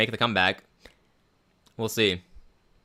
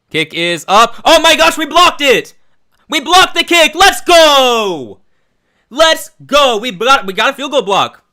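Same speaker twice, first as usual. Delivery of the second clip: high, loud, elated yelling; ecstatic shouting; 12 semitones higher, 10 dB louder, slower than usual. Real, not acted. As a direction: shout it like your team just won. Blocked kick, fan erupts.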